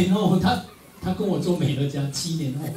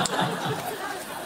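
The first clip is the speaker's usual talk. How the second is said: breathy laugh; 5 dB quieter than usual. Real, not acted. Laughter after the punchline.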